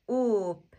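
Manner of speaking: "Oop" stretched slowly with a long vowel; drawn-out blend. Shown as a demonstration. Teacher blends sounds slowly first.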